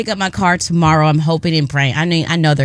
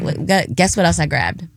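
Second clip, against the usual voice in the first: ordinary delivery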